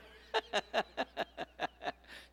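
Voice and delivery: long, rapid-fire cackle; loud laugh. Real, not acted. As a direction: laugh loudly at your own banter. Laughs while bantering with visitor.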